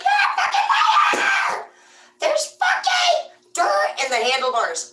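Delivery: very high, loud, slow furious yelling; hammed-up mother catching the lie; screaming mom. Mom screaming at the lying kids about the dirt, furious. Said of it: "She'd be like".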